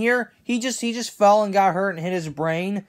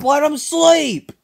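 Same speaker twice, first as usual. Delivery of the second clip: fake crying; 7 semitones higher, 4 dB louder, slower than usual. Pleading, crying heard.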